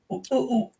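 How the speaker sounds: high squeaky mock whine; high mocking squeal of oh oh oh. Mocking someone going to toilet.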